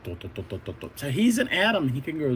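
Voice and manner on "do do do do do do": low, soft descending do-do effect; low soft mock sound effect; low growing sound. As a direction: hum a cartoon growing effect.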